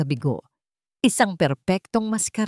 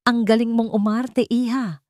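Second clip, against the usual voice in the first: bitter sarcasm; 5 semitones higher than usual. A narrator's reading. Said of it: "may mapait na ngiti".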